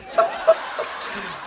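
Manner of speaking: breathy burst of laughter; amused chuckling; laughing. Real, not acted. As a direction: laugh at your own retelling. Laughs at his retold line.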